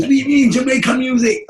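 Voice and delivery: loud, very high mock outburst; incredulous. Dad hearing of Jamaican music, incredulous shout. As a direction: fire the question out in high-pitched disbelief. Retold dad's incredulous question.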